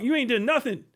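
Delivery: usual speaking voice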